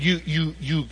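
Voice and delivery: stammering, imitating a stunned son; speechless sputter. Son learns dad gave away the Jeep, sputtering. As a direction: get stuck on the word, lost for speech. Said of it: "he couldn't".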